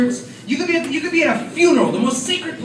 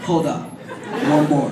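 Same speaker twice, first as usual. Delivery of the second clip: much lower, steady cool voice; low hold up; 11 semitones lower than usual. Retold or imitated. Brother insisting on one more insult, low cool. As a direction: hold your sibling off calmly. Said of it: "he's like".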